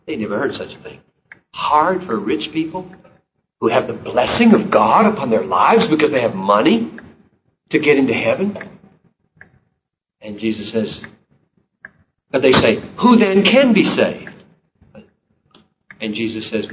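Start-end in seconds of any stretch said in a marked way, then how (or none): 1.5-8.6 incredulous. Retold or imitated. Disciples' incredulous echo of Jesus. 13.0-14.3 high, loud astonished question. Retold or imitated. Disciples ask Jesus in disbelief, high and loud.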